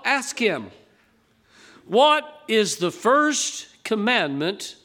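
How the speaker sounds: slow, weighty scripture reading to the congregation. Acted. Preacher reads Mark twelve to the congregation, solemn and measured. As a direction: let each verse land with gravity.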